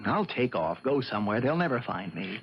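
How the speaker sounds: low voice, sinking as it goes; subdued resignation. Acted. Husband offers to go away alone, resigned.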